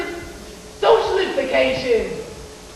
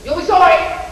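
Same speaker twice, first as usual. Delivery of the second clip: shouting after someone; 3 semitones higher, 7 dB louder than usual. Real, not acted. Shouting after departing man.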